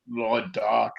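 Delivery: hollow ghostly voice. Acted. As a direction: speak as a spirit from beyond. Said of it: "I'm doing a ghost voice".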